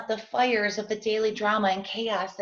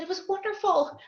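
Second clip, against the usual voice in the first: fake cheer; 11 semitones higher, faster than usual. Fibbing to husband about meeting.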